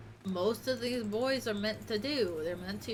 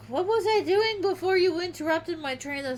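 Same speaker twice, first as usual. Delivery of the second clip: annoyed; 6 semitones higher, 7 dB louder than usual. Real, not acted. Complaining to pet.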